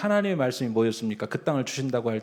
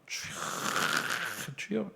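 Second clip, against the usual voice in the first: father's prayer; 11 dB quieter, much slower than usual. Retold or imitated. Father praying at the cemetery.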